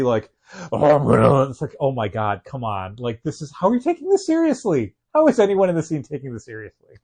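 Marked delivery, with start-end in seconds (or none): 0.7-1.5 brief loud gravelly growl; quick rough Batman rasp. Retold or imitated. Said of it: "every time he would do the". 1.8-7.0 incredulous. Retold or imitated. Retold disbelief at movie.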